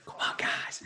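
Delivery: hushed mock coaching whisper; imitated whisper. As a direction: whisper it like a secret cue. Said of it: "whisper things at me".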